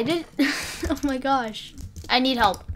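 usual speaking voice

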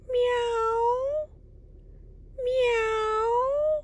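high, long-drawn meows; kitten meowing. Acted. Adult imitating two kitties for a child, high meows. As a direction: mew high like a little cat. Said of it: "Two kitties".

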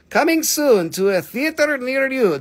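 high, loud hammed-up movie promo; mock trailer announcer. Rock seller plugs coming cabochons to viewers, mock trailer announcer.

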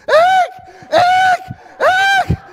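high, loud frantic shouting; wife screaming for him to grab the pole; wife yelling. Retold or imitated. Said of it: "He goes".

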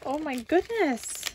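ordinary talk

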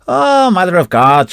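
slow, startled woman's cry; frightened gasp. A narrator's reading. Annie Bragan startled by the corporal, slow frightened cry. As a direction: voice Annie's shock.

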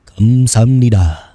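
slow, loud, put-on Korean thank-you; voicing the character. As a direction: give the lurking animatronic a mock voice. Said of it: "That's what he's saying right now".

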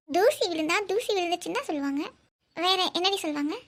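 ordinary delivery

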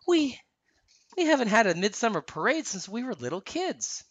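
high, wistful little voice; hesitant, mildly mournful, pitched high; sad. A narrator's reading. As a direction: say it with plaintive regret. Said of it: "Anna said sadly".

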